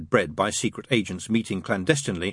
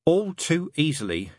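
slow, higher, mild groan; 8 semitones higher than usual. A narrator's reading. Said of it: "he groaned".

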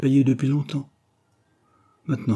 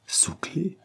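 breathless whispered disbelief; shocked whisper; 7 semitones higher, 8 dB quieter than usual. A narrator's reading. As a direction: echo it in a startled whisper. Shocked echo of locked up.

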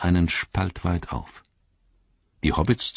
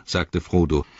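usual speaking voice

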